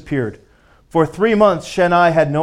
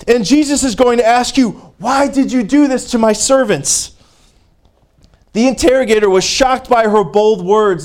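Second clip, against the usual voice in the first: much higher, louder preaching cry; fervent preaching; 8 semitones higher, 4 dB louder than usual. Real, not acted. Preacher driving home her testimony to the congregation, fervent and loud. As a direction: lift it to a fervent peak.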